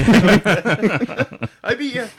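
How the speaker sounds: high, loud, very slow gloat through laughter; goofy gloating voice. Retold or imitated. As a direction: drag it out like a gloating kid. Retold character, laughing.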